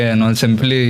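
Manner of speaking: ordinary delivery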